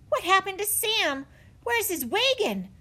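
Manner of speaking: high, anxious questioning; worried. A narrator's reading. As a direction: ask with mounting alarm. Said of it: "in a worried voice".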